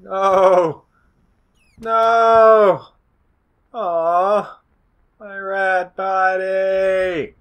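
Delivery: loud, high, stretched-out cries; hammed-up howl of loss; mock anguished wail. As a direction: wail it out in overblown grief. Mourns rat body in game.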